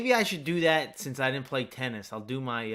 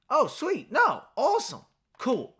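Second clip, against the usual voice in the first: excited reactions; 11 semitones higher than usual. Real, not acted. Reacting to the game.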